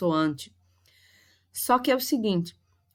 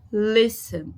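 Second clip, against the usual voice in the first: listen with a strong first syllable, weak ending; stress on first syllable; 2 semitones higher than usual. Shown as a demonstration. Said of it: "a sílaba mais forte foi o le". (listen com força na primeira sílaba)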